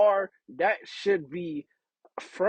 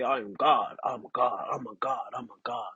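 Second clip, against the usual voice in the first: low-pitched boast, fading softer; mock bass voice; 6 semitones lower, 5 dB quieter than usual. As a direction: imitate puffed-up people in a deep voice. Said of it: "that same bass voice".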